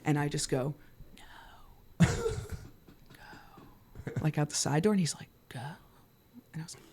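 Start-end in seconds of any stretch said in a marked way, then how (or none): 2.0-2.1 hushed, breath-only refusal; urgent whisper. Retold or imitated. Refusing the package over the DJ booth, urgent whisper. 5.5-5.6 barely audible command; urgent whisper. Retold or imitated. Sending a friend out the side door, urgent whisper.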